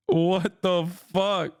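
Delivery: very slow, drawn-out disbelief; stunned. Real, not acted. Streamer stunned by a chat comment, drawn out slowly. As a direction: let it hang in stunned silence.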